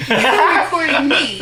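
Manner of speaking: laughing loudly through the line; loud laughing retelling. Retold or imitated. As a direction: laugh it out in disbelief. Laughing while retelling argument.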